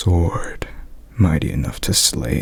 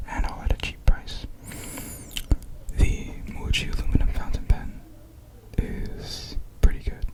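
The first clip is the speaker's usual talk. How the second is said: soft whispered review; gentle ASMR outro whisper; 5 dB quieter than usual. Real, not acted. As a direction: whisper the wrap-up close to the mic.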